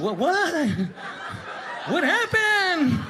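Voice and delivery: high, drawn-out hammed-up question; mock wary kid. Kid waiting for that hit, high mock alarm. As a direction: whine it like a kid expecting a hit.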